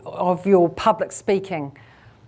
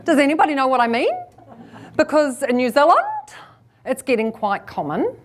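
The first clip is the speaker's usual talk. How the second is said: questioning lift on "mean", "New Zealand" and "common"; rising inflection; 3 semitones higher, faster than usual. Shown as a demonstration. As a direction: end each phrase on an upswing, on "mean", "New Zealand", "common". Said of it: "please ditch the rising inflection".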